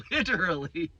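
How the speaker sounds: laughing through the word literally. Real, not acted. Joking with viewers about shitty sewer work, laughing. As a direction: break into a laugh at your own pun.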